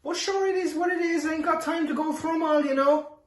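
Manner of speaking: high sing-song Cork voice; loud, high Cork lilt; Cork accent. Shown as a demonstration. Cork voice returns.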